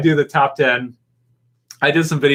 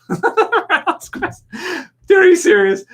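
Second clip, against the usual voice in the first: high, incredulous laugh breaking into a louder "are you serious"; can't believe the suggestion, laugh it off loud and high; laughing disbelief; 4 dB louder than usual. Real, not acted. Reacting to a chat suggestion, high laughing disbelief. Viewer suggests a dead character returning.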